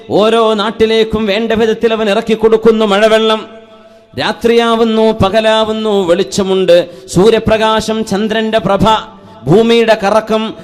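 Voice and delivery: loud, high-pitched sermon delivery; impassioned preaching. Real, not acted. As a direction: preach it out in ringing, sustained phrases. Preaches to congregation.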